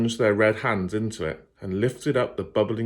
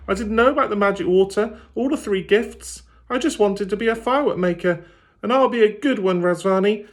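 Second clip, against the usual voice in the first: put-on much higher, louder earnest girl voice; pleading girl; 12 semitones higher, 5 dB louder than usual. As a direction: plead with all her heart. Lila pleads with the fiend.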